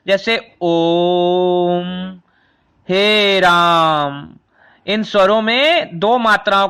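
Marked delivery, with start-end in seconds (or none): 0.6-4.3 "ओम" and "हे राम" stretched very slow and long, vowels held, lower; prolated vowel demo. Shown as a demonstration. Hindi teacher to students, drawing out "ओम" and "हे राम" very slowly. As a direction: hold each vowel of "ओम" and "हे राम" three times as long, as in chanting. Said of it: "जिनका लंबा उच्चारण किया जाता है".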